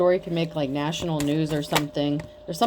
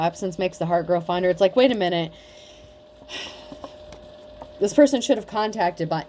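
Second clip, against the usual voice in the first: normal talking voice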